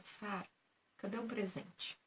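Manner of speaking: whispered. Retold or imitated. Teacher whispers to the little girl about the gift, soft mock whisper. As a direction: hush it like a secret in the child's ear. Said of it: "cochichou no ouvido dela".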